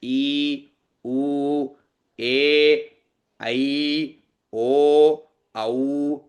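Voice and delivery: sustained, level long-vowel demonstration. Shown as a demonstration. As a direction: stretch आ, ई, उ evenly, one at a time. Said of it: "उतना दीर्घ इसका उच्चारण होना चाहिए".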